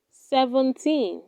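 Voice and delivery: ordinary talk, nothing marked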